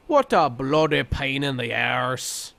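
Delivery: slow, hammed-up British accent as a cute character; mock British accent. Podcaster quoting the character Pudding, in a mock British accent. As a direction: drawl it out in a fake British accent. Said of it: "to quote my friend Pudding".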